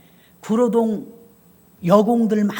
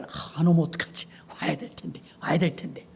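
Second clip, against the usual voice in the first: anxious murmur; 8 semitones lower, 9 dB quieter than usual. Retold or imitated. Pastor fretting on Saturday.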